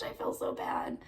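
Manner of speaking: hushed, ashamed I feel so bad; guilty whisper. Real, not acted. Fan whispering guilt to viewers about an unseen show. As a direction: confess it under your breath.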